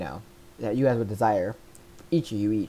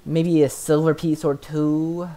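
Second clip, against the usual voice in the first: slow, drawn-out serpent trader, a touch louder; slow sly yuan ti; 6 semitones higher, 5 dB louder, much slower than usual. Acted. Yuan ti naming a price, slow and drawn out. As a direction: stretch each word like a bargaining snake-man. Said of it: "And he says".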